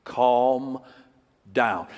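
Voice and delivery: low, soft, slow soothing words; calm the listener right down with a hush; a gentle hushed God telling you to calm down. Pretend. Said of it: "he will say to you in essence".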